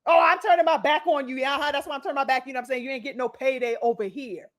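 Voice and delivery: sassy. Retold or imitated. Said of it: "Just sassy as it gets".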